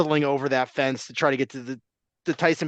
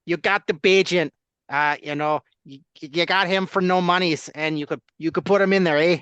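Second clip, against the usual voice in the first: put-on Canadian accent; 3 semitones higher, 3 dB louder than usual. Host riffing on cheap backup QB in mild Canadian accent. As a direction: ham up a Canadian lilt, ending on eh. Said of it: "He started doing Canadian voices".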